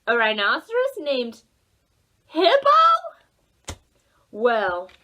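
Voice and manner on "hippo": high, loud incredulous squeal; can't believe the name; amazed disbelief. Acted. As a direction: squeak it out in total surprise. Disbelief at rhino named Hippo.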